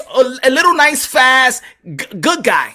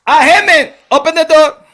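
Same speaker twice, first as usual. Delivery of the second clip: raised-voice demand at a door; Manny Pacquiao calling; 3 semitones higher, 4 dB louder than usual. Acted. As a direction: call out firmly in an imitated accent. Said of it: "Manny Pacquiao was, went to the office".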